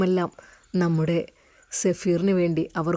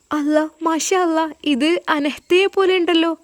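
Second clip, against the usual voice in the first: higher, surprised exclamation; amazed; 9 semitones higher than usual. Acted. Lawyer seeing the girl's photo, surprised and higher. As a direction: react to the photo in wonder.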